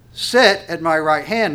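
Sit hit loud and drawn out, then on through the phrase; stress on Sit. Shown as a demonstration. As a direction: punch the word Sit, slowly. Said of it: "I want to emphasize the word".

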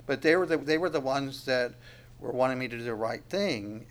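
ordinary talk

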